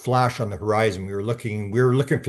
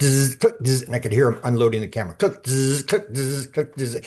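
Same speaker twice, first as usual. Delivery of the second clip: camera click imitation; 3 semitones higher than usual. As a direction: mouth clicks and buzzes like a motor drive. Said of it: "So it would go click".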